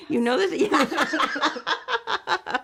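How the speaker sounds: laughing. Real, not acted. Laughing at compliment.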